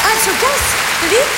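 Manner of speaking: high mocking echo of the friend; mock caller voice. Son parrots his friend's question, high and mocking.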